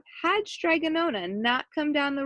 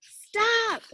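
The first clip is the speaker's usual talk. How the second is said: high desperate yell; yelled stop; 10 semitones higher than usual. A narrator's reading. Big Anthony yells at the bubbling pot, high desperate shout. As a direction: shout it to halt the pasta. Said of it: "yelled Big Anthony".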